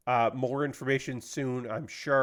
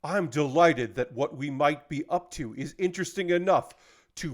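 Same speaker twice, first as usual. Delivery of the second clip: magician voice; 3 semitones higher than usual. Pretend. YouTuber reading the CEO's statement as a showy stage magician. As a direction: announce it like a grand stage magician. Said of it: "in my best magician voice".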